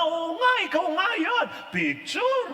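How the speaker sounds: high, loud, eager; family crowding in, excited and loud; excited relatives. Retold or imitated. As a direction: rush in thrilled and loud. Relatives rushing over.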